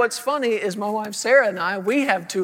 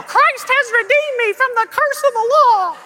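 very high, loud squeak; hammed-up child echoing on one high note; puppet child voice; 7 dB louder than usual. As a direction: parrot it shrilly like a tiny puppet. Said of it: "a little puppet voice when I was a child".

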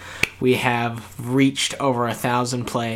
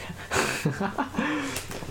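high giggle; 13 semitones higher, 6 dB quieter than usual. Real, not acted. Laugh after the whisper bit.

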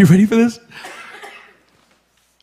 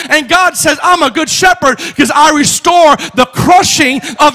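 high, loud, shouted preaching; full-force preaching; 8 semitones higher, 6 dB louder than usual. Real, not acted. Pastor preaching to his church, full-force shouting. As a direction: preach it to the rafters.

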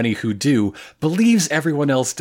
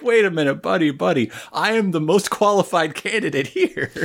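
lightly voiced boss arguing his case, higher pitch; mock job candidate; 6 semitones higher than usual. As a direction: imitate a protesting job applicant. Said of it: "responds as if this is a job interview".

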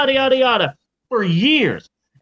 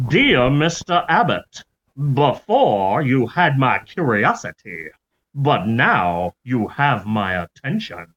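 mock Southern gentleman reading his letter; lightly Southern accent; 5 semitones lower than usual. Author writing back to the prisoner, in a light Southern accent. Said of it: "I'm giving him a Southern accent".

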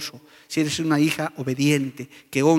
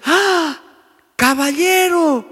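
put-on high, loud old woman calling out; old lady; 12 semitones higher, 6 dB louder, much slower than usual. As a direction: call out like an elderly vendor. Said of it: "una señora mayor, me dice". (señora mayor llamando, aguda y fuerte)

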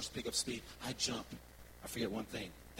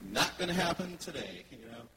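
higher, louder, laughing; laughing refusal; 3 semitones higher, 6 dB louder than usual. Real, not acted. Preacher laughing to congregation that he won't ride, higher and louder.

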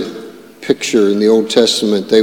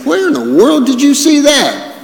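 mock incredulous; 13 semitones higher than usual. Preacher reacting to some stuff people say, mock incredulous. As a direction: ask it way up high in exaggerated disbelief.

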